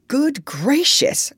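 high, slow astonished exclamation; startled cry. A narrator's reading. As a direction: cry out in amazement. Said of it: "cried the doctor".

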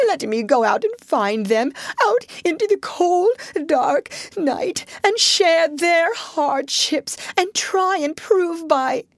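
high, tearful acted plea; sobbing. A narrator's reading. Toad pleading to join his friends, acted sobbing. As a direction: beg through hammed-up sobs. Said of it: "sobbed Toad, shedding bitter tears".

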